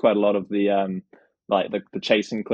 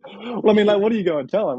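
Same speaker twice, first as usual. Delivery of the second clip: ordinary delivery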